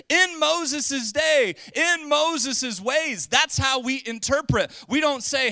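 slow, high, loud preaching cadence; preaching at full force. Acted. Pastor drives home his point to the congregation, slow and loud. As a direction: proclaim each phrase slowly to the church. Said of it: "preaching emphasis".

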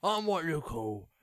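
slow, loud British villain voice; Penguin impression. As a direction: drawl it like the Arkham Penguin. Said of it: "British Nolan North impression".

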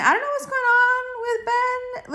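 squealing high gossip; high gossipy squeal. Real, not acted. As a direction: pitch it up, dishing gossip. Gossiping about Ben.

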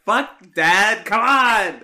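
loud, high exasperated shout. Retold or imitated. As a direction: yell at dad in mock outrage. Exasperated reply to dad's pointless call.

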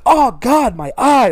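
high mock cry of pain; imitated pained referee. Retelling the ref poked in the eye, mock pained. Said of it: "the ref is like".

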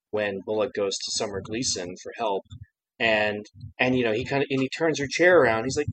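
ordinary talk

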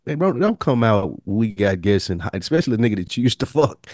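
ordinary talk, nothing marked

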